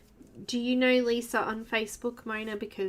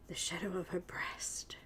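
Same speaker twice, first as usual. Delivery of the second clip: whispering; 3 semitones lower, 10 dB quieter than usual. Real, not acted. Whispered aside while painting.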